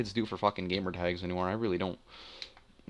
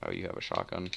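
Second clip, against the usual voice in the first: normal talking voice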